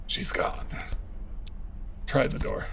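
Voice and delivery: unvoiced, hushed whisper. Real, not acted. Player whispering while sneaking to the locked door, hushed. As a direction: whisper so nobody hears.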